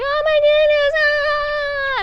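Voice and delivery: squealing very high delight; high thrilled squeal; gushing onlookers. Retold or imitated. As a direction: shriek like excited fans. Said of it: "all these people be like".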